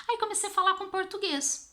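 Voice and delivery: high-pitched, excited retelling; excited discovery. Retold or imitated. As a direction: lift the voice high as the idea pays off. (voz aguda e empolgada de descoberta)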